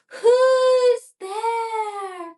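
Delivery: put-on high, loud call of an old grandmother. A narrator's reading. Grandmother in bed calls out to the knock, high and loud. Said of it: "called the grandmother".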